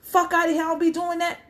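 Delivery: high-pitched indignant retort; mock huffy comeback in a high voice; offended. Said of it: "if they get offended".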